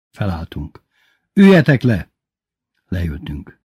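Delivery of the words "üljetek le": loud, higher-pitched order; barked command. A narrator's reading. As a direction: order the class down. Teacher drilling the class. (hangos, kurta parancs)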